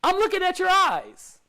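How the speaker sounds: high, lightly voiced old doctor; a hint of an old doctor leaning in close; doctor's voice. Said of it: "He said".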